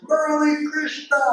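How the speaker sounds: a high, ringing call of his name across the veranda; joyful call. Retold or imitated.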